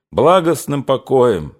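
plain reading voice

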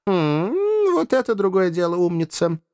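smug courtly lilt; pleased master. A narrator's reading. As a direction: praise the pet, then address the ladies gallantly. Master praises, turns to ladies.

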